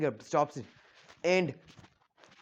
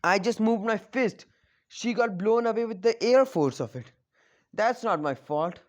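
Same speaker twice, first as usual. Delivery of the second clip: higher, louder mock-innocent excuse; innocent protest; 5 semitones higher, 5 dB louder than usual. Naruto protesting innocence to Iruka, higher and louder. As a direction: play dumb, defending himself loudly. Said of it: "Naruto goes".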